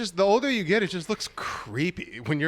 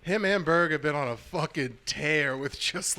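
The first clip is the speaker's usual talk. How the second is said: ordinary delivery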